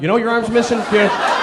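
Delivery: usual speaking voice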